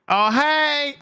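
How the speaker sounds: high, bright mock-casual greeting; Deadpool voice. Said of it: "you hear Deadpool's voice going".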